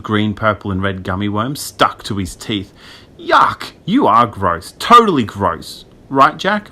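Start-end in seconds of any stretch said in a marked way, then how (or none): 3.2-6.7 disgusted. A narrator's reading. Ash disgusted, seeks agreement.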